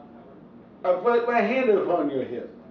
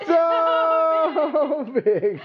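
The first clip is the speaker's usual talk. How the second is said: excited shout; 10 semitones higher than usual. Real, not acted. Playing so big game.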